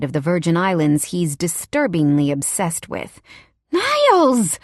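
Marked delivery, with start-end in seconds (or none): 3.7-4.6 the name flung high and loud; sharp warning hiss. Acted. Wife warning her husband, "Niles!" flung high and loud. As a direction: cut him off sharply. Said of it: "the queen bitch hisses".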